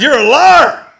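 loud, high accusing shout; angry accusation. Retold or imitated. Car buyer confronting the seller in the church hall, angry shout. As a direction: hurl the accusation at full voice. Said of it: "he calls me a liar".

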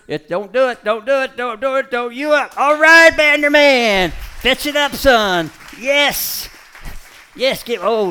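high, loud shouting at the dog; excited cheering. Real, not acted. Trainer cheering his dog past the squeaker, high and loud. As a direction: cheer him on at full voice.

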